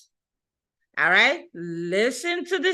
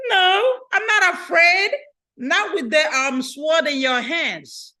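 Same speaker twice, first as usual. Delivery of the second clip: wife answering; 6 semitones higher than usual. Acted.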